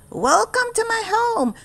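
high, squeaky little mouse welcoming. Acted. Country Mouse welcoming his cousin, high mouse voice. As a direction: play a tiny friendly mouse.

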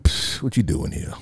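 breathy, dismissive cat sneer; unvoiced brush-off from a cat who doesn't care; cat with attitude. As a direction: scoff at the owner like a snooty cat. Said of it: "with an attitude like".

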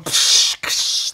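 airy explosive psh and ksh; blast sound effects. Shown as a demonstration. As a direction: spit out the blast noises. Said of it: "the sound effect".

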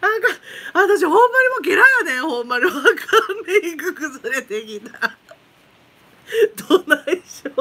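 high, rushed laughing chatter; still giggling. Real, not acted. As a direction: ride the end of a giggle fit. Laughing fit wrecks her makeup. (崩れるメイクに笑いながら)

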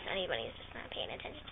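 hushed, unvoiced murmur; whispered aside. Real, not acted. Owner plans to get the cat, whispering. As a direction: whisper a plan under your breath.

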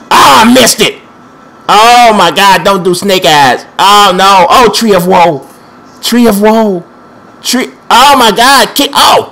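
loud, high yelps of dismay; alarmed exclaiming. Real, not acted. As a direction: cry out as the attack goes wrong. Losing control of game fight.

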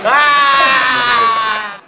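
a loud laughing yell; laughing shout. Acted. Cook bursts out at the customer story, laughing yell. Laughing outburst in kitchen skit.

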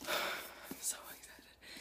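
slow, soft breathy whisper after a sigh; excited whisper. Real, not acted. About to read her new book on camera, a soft excited whisper. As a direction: hush your thrill before reading. Hushed anticipation.

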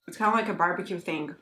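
words muffled by crunching; talking while chewing. Real, not acted. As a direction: mouth partly full of chip. Seen 0: Bites chip before and around 1-2s, chewing while speaking.

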